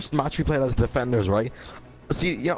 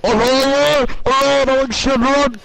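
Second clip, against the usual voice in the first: much higher, louder panicked yelps; mock panic; 11 semitones higher, 9 dB louder, much slower than usual. Retelling a teammate spotting the bomb, loud panicked yelps. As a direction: imitate a teammate fleeing a bomb. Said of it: "I see him like".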